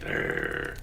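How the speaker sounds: wolf growl. A narrator's reading. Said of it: "the wolf showed his teeth".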